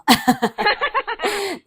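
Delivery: high-pitched real giggle; high giggle breaking out. Real, not acted. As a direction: giggle at your own joke. Laughing at own teasing.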